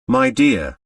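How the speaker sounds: usual speaking voice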